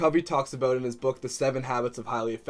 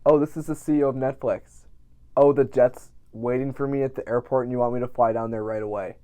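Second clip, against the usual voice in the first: pretend phone call; 5 dB louder than usual. Pretend phone call from Netflix CEO.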